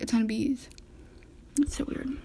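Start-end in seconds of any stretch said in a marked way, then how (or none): 1.6-2.2 hushed aside; whispered. Real, not acted. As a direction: whisper it to yourself. Whispered aside about bees.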